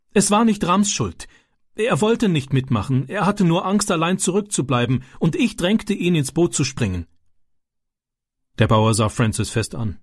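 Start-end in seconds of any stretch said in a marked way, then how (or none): 0.1-7.1 eager, brave. Acted. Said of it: "sagte er laut und mutig".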